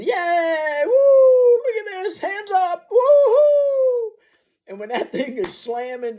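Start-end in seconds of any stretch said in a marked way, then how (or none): 0.0-4.1 much higher, whooping cheers, loud on the woos; roller-coaster cheering, arms up; hooping and hollering. Retold or imitated. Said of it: "we're hooping and hollering".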